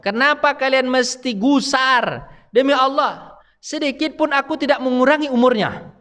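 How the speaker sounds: high, slow, solemn voice of the angel of death. Retold or imitated. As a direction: speak as the angel rebuking the mourners. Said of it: "maka berkatalah malaikat maut". (malaikat maut menegur, suara tinggi dan lambat)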